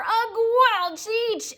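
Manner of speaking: high, slow, disgusted giant's retching cry; roaring giant. Acted. Bloodbottler tastes the snozcumber, disgusted roar. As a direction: spit the word out in disgust. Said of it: "roared the blood bottler".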